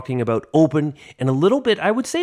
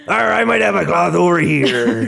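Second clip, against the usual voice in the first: pirate voice; 3 semitones higher, 3 dB louder than usual. Acted. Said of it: "your pirate cloth".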